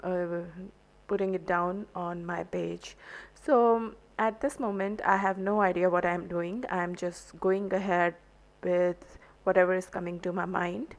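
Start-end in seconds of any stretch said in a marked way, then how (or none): none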